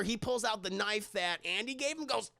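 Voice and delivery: normal talking voice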